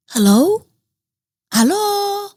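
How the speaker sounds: second hello high and wailing; mock calling out. Pretending nobody hears her, calling high. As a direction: call out, then break into a fake cry. Comic calling out, then crying.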